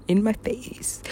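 very soft, slow, almost whispered; hushed and drawn out; hushed recollection. Retold or imitated. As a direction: drop to a near whisper. Retelling bass drop, first take.

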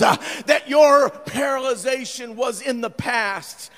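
loud, ringing sermon delivery; full-force preaching. Acted. Pastor preaching loudly to the congregation. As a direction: preach it out to the whole church.

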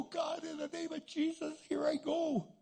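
high-pitched, frantic appeal to God; retold panicky plunge into action; desperate prayer. Chaplain prays before attempting chest compressions, mock-desperate prayer.

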